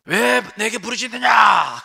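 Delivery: high, loud, slow shouted rebuke; God's rebuke. Acted. God rebuking hesitant Moses at sea, shouted. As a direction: thunder it down at Moses. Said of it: "그러시는 거죠".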